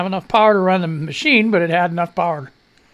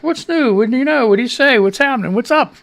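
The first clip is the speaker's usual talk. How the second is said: playful greeting variations; 6 semitones higher, 4 dB louder than usual. Shown as a demonstration. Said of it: "How many other ways can I say it?".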